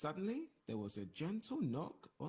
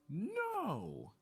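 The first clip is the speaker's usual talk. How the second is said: sharp, loud, high shout of refusal; the taxman shouts no at the couple; 14 semitones higher, 5 dB louder than usual. A narrator's reading. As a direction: bark a flat-out no. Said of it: "the taxman shouted".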